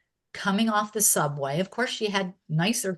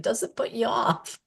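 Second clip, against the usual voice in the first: whispered question; 5 semitones higher than usual. Retold or imitated. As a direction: breathe the question out, no voice. Elderly woman asks, retold quietly.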